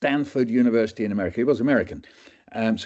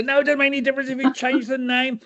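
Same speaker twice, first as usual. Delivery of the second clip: much higher, fast exasperated put-down; John Cleese; faster than usual. Retold or imitated. Doctor mocks students' answer, high and fast. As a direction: snap back a rapid, fussy rebuke. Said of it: "It turned into John, John Cleese now".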